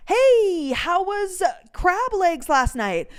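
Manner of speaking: high, drawn-out cheery greeting; LaDonna. Retold or imitated. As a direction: voice a friend's loud, high-pitched hello. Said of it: "LaDonna goes".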